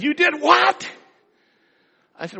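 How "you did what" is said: high, shocked cry of disbelief; mother hearing what her son mixed, stunned. Retold or imitated. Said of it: "And my mother said".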